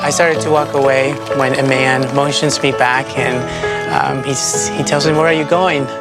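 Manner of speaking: ordinary talk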